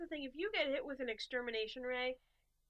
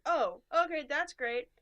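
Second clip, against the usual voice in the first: cheerful recruiter; 4 semitones higher, 8 dB louder, slower than usual. Retold or imitated. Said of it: "They're like".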